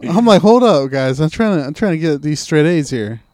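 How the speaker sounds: loud mock protest. Real, not acted. Teasing friend loudly protests he was studying, mock outrage. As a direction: insist loudly, laughing it off.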